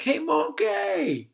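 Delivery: high, slow, silly call; silly greeting. Retold or imitated. Jordan the jumping friend greets the monkey, high and silly. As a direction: stretch it out like a goofy kid. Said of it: "saying things like".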